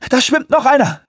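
high, loud urgent call. Acted. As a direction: call it out across the boat. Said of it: "rief Stringer".

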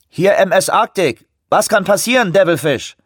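high, loud voice over the radio; over walkie talkie. A narrator's reading. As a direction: answer through a crackling radio. Ship answers by radio.

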